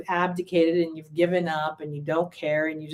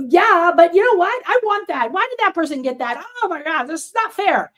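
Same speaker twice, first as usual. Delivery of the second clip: higher, louder envious complaint; acting out a resentful, grabbing rant; mock aggrieved protest, pitch jumped up; aggressive; 10 semitones higher, 5 dB louder than usual. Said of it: "And now you're aggressive".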